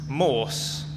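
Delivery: ordinary delivery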